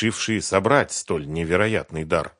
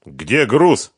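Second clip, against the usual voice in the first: loud, raised angry demand; yelling; 5 semitones higher, 10 dB louder than usual. A narrator's reading. Фёдор interrogating Сухарев about the cargo, yelling. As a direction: yell the question at him. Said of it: "заорал Фёдор".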